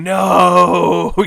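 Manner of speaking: loud, high mock scream of no. Mutant screaming as Susan falls to her death, loud scream. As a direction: belt out a shocked cry. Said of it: "one of them is even screaming".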